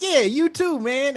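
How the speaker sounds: very high, loud forced cheer; mock enthusiastic reply. Visitor returning an odd warm welcome, overdone excitement. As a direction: play along with exaggerated, squeaky-high eagerness. Acted awkward reply to gushing locals.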